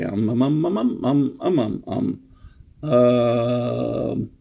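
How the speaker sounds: playful run of filler ums, ending on a long one; deliberate string of ums. Pretend. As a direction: pile up ums as if clearing them out. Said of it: "Let's just get those out of the way".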